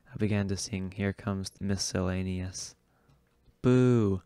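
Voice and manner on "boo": loud jeering boo; mock boo. Narrator booing his own song, loud mock boo. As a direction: boo yourself off the stage.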